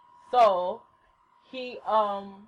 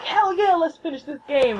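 eager, higher-pitched fan reaction; reenacted excited cheer; 6 semitones higher than usual. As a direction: echo it back, raring to go. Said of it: "and I'm all excited".

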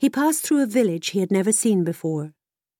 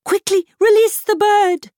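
much higher urgent horse voice; whinny the order urgently; whinnying mare; 13 semitones higher, 6 dB louder than usual. A narrator's reading. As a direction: mare urging the boy to save the fish, whinnying. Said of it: "whinnied the mare".

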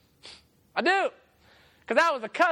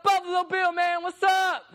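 high, louder call to a friend; calling out across the street; 8 semitones higher, 3 dB louder than usual. Retold or imitated. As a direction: shout a greeting across the street.

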